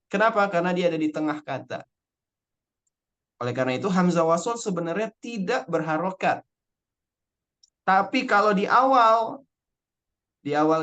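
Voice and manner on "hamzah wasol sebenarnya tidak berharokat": ordinary talk, nothing marked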